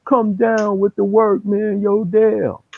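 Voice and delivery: high, loud calling up to a window; yelling up to Dale. Retold or imitated. Guys in the lot yelling up to Dale, high and loud. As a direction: shout up for Dale to come down. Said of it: "yelling at my man".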